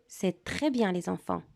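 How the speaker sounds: normal talking voice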